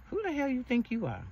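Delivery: imitating her younger self snapping at her boyfriend; defiant retort. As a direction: fire it back high and indignant.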